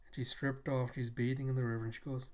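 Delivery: normal talking voice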